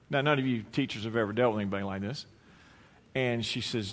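normal talking voice

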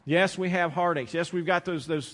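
ordinary talk

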